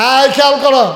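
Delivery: high, loud call to listeners; calling for attention. Real, not acted. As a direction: raise your voice to grab their attention.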